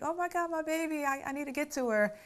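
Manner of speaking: worried mother. Retold or imitated. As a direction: voice a mom panicking over her baby. Mother frantic about sick daughter.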